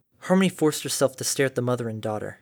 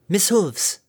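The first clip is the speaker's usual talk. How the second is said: higher, loud call across distance; 7 semitones higher, 4 dB louder than usual. A narrator's reading. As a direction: call out with strong lungs. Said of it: "Harmony called out with strong lungs".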